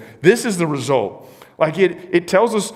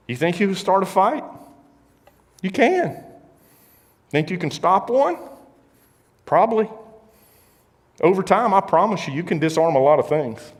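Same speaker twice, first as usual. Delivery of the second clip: rising preacher's challenge; 8 semitones higher than usual. Real, not acted. Preacher driving home a point.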